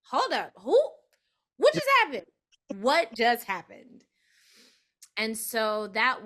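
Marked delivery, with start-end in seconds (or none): 0.0-0.8 1 stunned disbelief. Retold or imitated. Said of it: "I was like". 1.6-4.0 high, loud stunned repeat; shocked disbelief. Retold or imitated. Telling a friend how the news floored her, loud and stunned. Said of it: "I was like".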